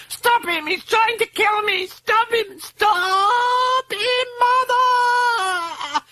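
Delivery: shrieking terror, very high; screeching. A narrator's reading. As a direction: scream for rescue, stretching the last words. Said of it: "she was screeching".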